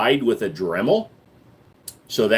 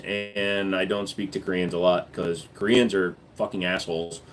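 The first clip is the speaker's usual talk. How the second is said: ordinary talk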